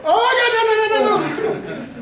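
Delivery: high, loud laughing wail of no; a mother's shrill, laughing refusal; mom's laughing protest. Retold or imitated. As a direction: shriek the nos like an embarrassed mom. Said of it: "mother was like".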